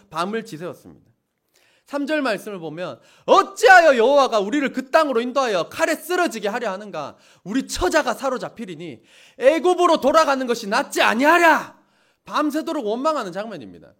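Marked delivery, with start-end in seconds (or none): 3.2-11.8 much louder, wailing reproach; loud lament. Acted. Preacher voicing the Israelites crying out, loud lament. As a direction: cry out against God with the crowd. Reading the people's lament loudly.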